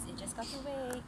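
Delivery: usual speaking voice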